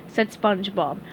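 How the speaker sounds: usual speaking voice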